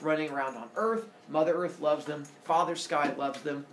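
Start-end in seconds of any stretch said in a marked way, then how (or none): none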